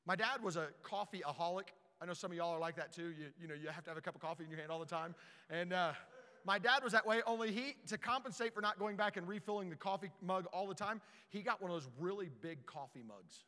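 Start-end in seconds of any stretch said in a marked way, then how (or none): none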